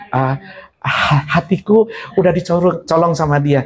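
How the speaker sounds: a coy lovesick believer bargaining with God; whiny believer. Acted. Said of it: "lovesick believer again". (orang Kristen merayu Tuhan, suara rendah malu-malu)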